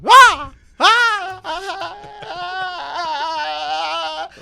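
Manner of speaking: high, loud yelps of pain. Retold or imitated. Reliving the burning, yelping in high pain. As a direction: yelp out in agony on the toilet. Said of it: "I started yelping".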